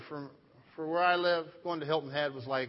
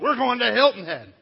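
loud, high imitation of excited kids; mock kids' excited shout; 6 semitones higher, 10 dB louder than usual. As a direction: belt it out like kids bound for vacation. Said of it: "That's all they talked about".

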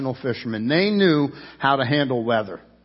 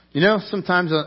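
ordinary talk, nothing marked

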